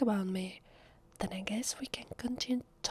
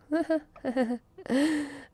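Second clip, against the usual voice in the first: high, louder burst of laughing; incredulous laughter; 7 semitones higher, 5 dB louder than usual. Acted. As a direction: laugh at the absurd idea. Amused by vampire question.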